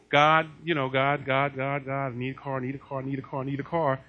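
low, droning repeated plea; mock confessing. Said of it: "And you've been confessing".

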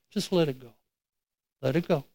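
soft, gentle prompting; tender, hushed nudge; gently. Retold or imitated. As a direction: coax quietly, letting the voice drop. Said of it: "the Holy Ghost just gently said".

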